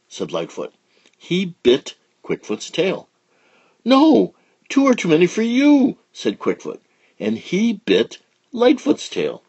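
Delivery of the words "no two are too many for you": squeaky angry protest; loud high quarrel; high squabbling child. Acted. Little alligator quarrel.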